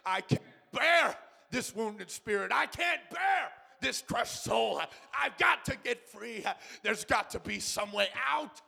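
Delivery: rising to a loud pleading cry; a desperate sinner breaking free, loud and high. Shown as a demonstration. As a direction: cry out from behind the bars. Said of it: "you've got to cry out".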